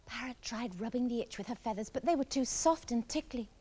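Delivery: usual speaking voice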